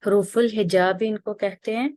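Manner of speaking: ordinary talk, nothing marked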